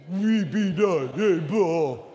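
low, slow pout; pouty whine. Shown as a demonstration. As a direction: whine it with pouted lips. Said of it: "A little bit more pouty".

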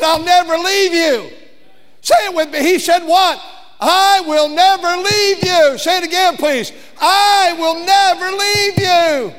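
loud, high, leading the congregation; rallying call. Acted. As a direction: get the church to say it with you.

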